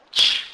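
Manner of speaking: isolated "ch" as in church, loud burst; "ch" alone, a strong unvowelled burst; CH sound demonstrated. Shown as a demonstration. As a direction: model a bare, forceful "ch". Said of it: "pronounced like the C H in the word church".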